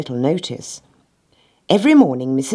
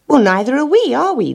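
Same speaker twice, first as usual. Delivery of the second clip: high-pitched chorus of puzzled animals; 11 semitones higher, 4 dB louder than usual. A narrator's reading. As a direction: chime in together, pitched high. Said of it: "they chorused".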